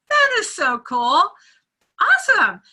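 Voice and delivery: high, loud and delighted, rising to a squeal on Awesome; excited. Retold or imitated. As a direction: gush over the wombat news. Reacting to wombat news.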